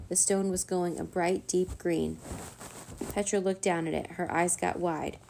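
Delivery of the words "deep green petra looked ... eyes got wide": ordinary delivery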